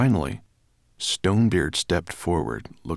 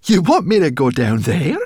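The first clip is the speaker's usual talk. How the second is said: high, loud, incredulous question; alarmed brownie; 11 semitones higher, 11 dB louder than usual. A narrator's reading. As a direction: balk at it in shrill-pitched alarm. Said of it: "in alarm".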